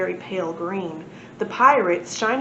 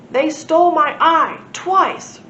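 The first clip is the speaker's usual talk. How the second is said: big, loud, slow booming complaint; rumbling volcano god; 7 semitones higher, 8 dB louder, slower than usual. A narrator's reading. Volcano god complaining to the crowd, slow loud rumble. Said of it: "rumbled Mighty Splod".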